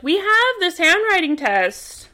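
a hint of pompous cops announcing proof; mock official voice. Investigators quoted mockingly.

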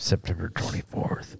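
unvoiced whisper. Real, not acted. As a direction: breathe the words with no voice. Whispered teasing date.

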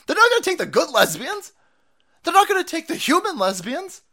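loud, high incredulous rant; heated shouting. Real, not acted. Host warns about the utopia dream, heated shouting. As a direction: shout it with rising endings.

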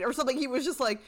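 ordinary talk, nothing marked

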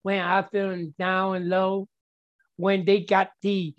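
ordinary talk

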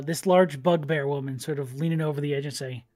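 ordinary delivery

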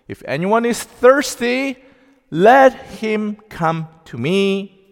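Jesus crying out to the thirsty crowd, loud proclamation. Retold or imitated. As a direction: cry it out to the crowd, slow on the second half. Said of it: "said in a loud voice".

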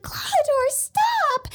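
very high, loud wail; hammed-up pleading cry. Kaya begging Clawhador to stop, high pleading cry. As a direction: cry out in mock desperation. Kaya's plea to Kuro acted out.

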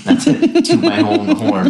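laughing. Real, not acted. Laughing brag.

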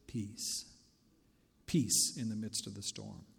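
low, very soft reassurance; hushed calm. Acted. Calm set against the storm.

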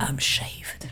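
sultry breathy whisper. Pretend. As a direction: breathe it close and low. Sexy whisper bit continues.

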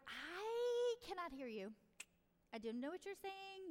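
high, stretched-out refusal to a whiner; firm parent. Retold or imitated. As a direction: pitch it up and draw out the words. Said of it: "So I was like".

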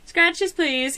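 high, put-on pleading horse voice. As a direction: beg like a pushy horse. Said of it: "he's just like".

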